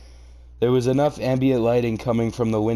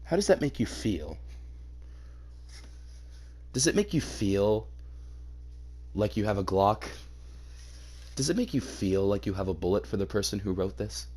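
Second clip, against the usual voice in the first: soft, low, deadpan questioning; hushed, understated mock therapy voice; mock therapist; 4 semitones lower, 10 dB quieter than usual. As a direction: ask it softly like a creepy counselor.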